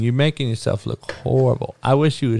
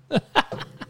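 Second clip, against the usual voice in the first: laugh; 6 dB quieter than usual. Real, not acted. Laughs at own impression.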